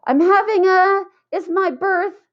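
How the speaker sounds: high, rushed blurting; nervous blurt. A narrator's reading. Colleen blurting out her birthday invitation to Jeff, high rushed. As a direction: blurt it out before losing nerve.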